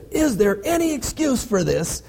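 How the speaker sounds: high-pitched mock yell, held back in volume. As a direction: imitate an angry teacher yelling, without real loudness. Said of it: "I remember him yelling this".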